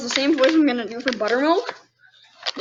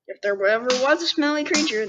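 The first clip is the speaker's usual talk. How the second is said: ordinary delivery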